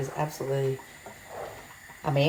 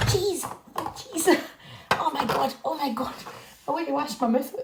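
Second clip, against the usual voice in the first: high-pitched mock disgust, like a fussy eater gagging; squeaky disgusted husband; 12 semitones higher than usual. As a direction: squeal it out as the grossed-out husband who hates cheese. Mimics Martin disgusted by the cheese.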